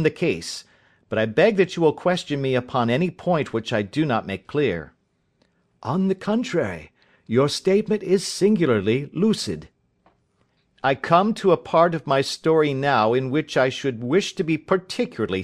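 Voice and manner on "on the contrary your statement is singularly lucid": higher-pitched listener's voice, measured; lightly voiced detective reassuring a client; Holmes answering his client.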